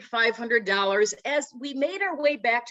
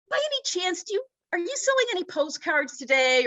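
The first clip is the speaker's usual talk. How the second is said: polite timid question; 7 semitones higher than usual. Retold or imitated. Asking the owner, high pitch.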